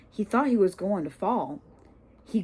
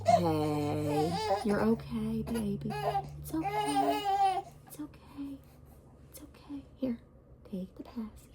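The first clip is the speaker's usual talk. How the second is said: much softer, higher, fading to a whisper; 9 semitones higher, 6 dB quieter than usual. Real, not acted. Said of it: "soothing a crying baby".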